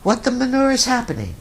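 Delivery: high, fast incredulous yelp; mock bewildered. Host mocking people caught off guard, high bewildered squawk. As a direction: play the baffled bystander. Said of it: "there's always a whole bunch of people that say".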